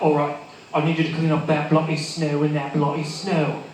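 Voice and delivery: put-on snow-plow boss ordering in a British accent. Snowplow boss tells worker to clean up, British accent. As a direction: give the order, British style. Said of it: "an excuse for me to do an accent".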